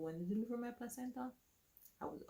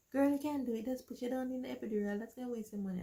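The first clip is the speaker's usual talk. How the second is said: higher, louder insistent urging; 7 semitones higher, 5 dB louder than usual. Retold or imitated. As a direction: talk her out of it firmly. Everyone urging her.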